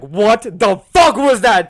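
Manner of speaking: loud, drawn-out mock teacher outrage; mean teacher. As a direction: shout it slowly like an appalled teacher. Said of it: "She was a very mean teacher".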